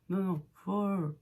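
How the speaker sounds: usual speaking voice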